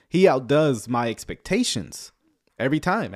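ordinary delivery

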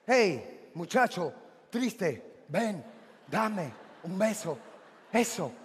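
low, soft, halting seducer; low pauses between soft words; suave self-talk. Acted. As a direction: murmur it slowly, word by word. Voices his own suave thoughts.

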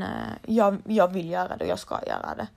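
ordinary delivery

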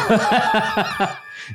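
high burst of laughter. Real, not acted. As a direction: crack up again. Bursts out again.

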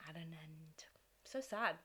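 hushed, fading whisper; mock-mournful whisper. Host mourning Cage's short marriage, mock-mournful whisper. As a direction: whisper it in pretend grief.

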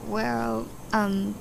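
usual speaking voice

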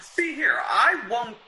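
high loud indignant protest; indignant refusal. Acted. Writer shouting his refusal at the blackmailer. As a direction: push back hard against the threat.

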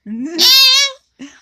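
very high, loud imitated meow; be the cat at dawn. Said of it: "Deu cinco horas da manhã". (miado de gato, agudo e alto)

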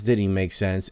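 usual speaking voice

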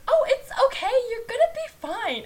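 sing-song reassurance, hiding the panic; fake reassuring. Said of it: "But you're like".

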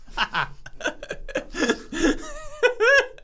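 long high-pitched laughing fit; helpless laughter. Real, not acted. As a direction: laugh hard until it nearly sounds like crying. Cracks up at own joke.

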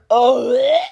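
loud, high disgusted retch; gagging noise. Acted. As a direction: gag loudly on the "oh". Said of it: "gag no-, noises".

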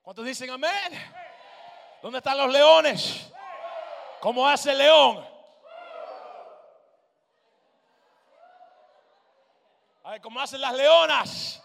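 high, loud call for a response; rallying shout. Real, not acted. Preacher asking the congregation to roar, rallying shout. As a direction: rouse the crowd at full voice.

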